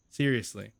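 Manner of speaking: normal talking voice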